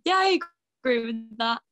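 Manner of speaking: high, loud, quick yes-woman chirp; bland agreeing. Retold or imitated. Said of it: "then I just say".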